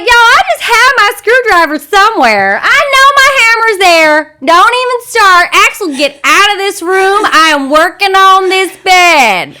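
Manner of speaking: loud, high, frazzled Southern rant; hammed-up Southern outburst, dragging the last words; heavy Southern accent. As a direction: lose your temper in a thick Southern accent. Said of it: "I go heavy on the Southern accent".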